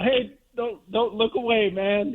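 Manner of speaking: teary plea. Retold or imitated. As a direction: beg her not to look while welling up. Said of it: "I was like".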